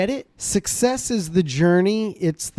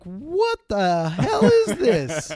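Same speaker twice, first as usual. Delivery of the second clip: laughing disbelief; 4 semitones higher, slower than usual. Retold or imitated. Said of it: "And I was like".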